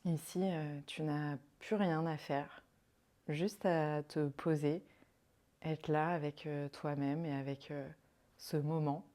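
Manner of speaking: calm, unhurried meditation voice with long pauses; guided meditation. Acted. Yoga guide leading a listener into rest and counted breathing, guided meditation. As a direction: guide them softly into rest, leaving space between phrases.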